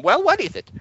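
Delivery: high, testy question; impatient professor. Acted. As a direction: snap it like an impatient boss. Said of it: "He takes his hand off the intercom".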